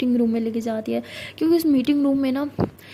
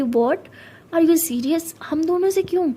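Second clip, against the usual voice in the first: surprised; 4 semitones higher, slower than usual. Acted. Saniya hears Joya is jealous, stunned disbelief. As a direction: pitch climbing in shock.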